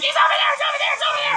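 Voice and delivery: put-on children squealing and pointing; shrill chorus of excited children; excited kids shouting. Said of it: "All the children are like".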